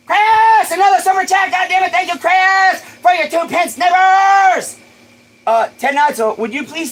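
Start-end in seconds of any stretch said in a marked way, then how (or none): 0.1-4.8 loud, high, thrilled yelling of thanks; excited shout. Real, not acted. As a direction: shout the thanks at full joy. Thanking donors, livestream hype.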